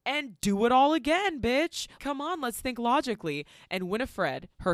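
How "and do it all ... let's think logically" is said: high, loud, exasperated mock appeal; mock exasperated urging. Host voices witch arguing with her sister, exasperated. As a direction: urge it as if arguing sense, pitched up.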